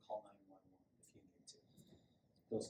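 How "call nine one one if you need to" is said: whisper. Shown as a demonstration. Whispered safety aside.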